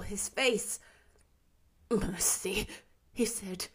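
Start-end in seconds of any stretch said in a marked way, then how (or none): 1.9-2.7 breathless frightened whisper; terrified whisper. A narrator's reading. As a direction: whisper it in terror before the ghost. Scrooge terrified before ghost.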